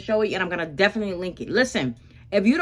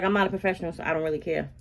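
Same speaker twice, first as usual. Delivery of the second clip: usual speaking voice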